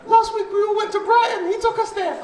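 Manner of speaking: put-on high little girl voice; little daughter voice. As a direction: squeak it like a small girl bragging. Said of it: "she was like".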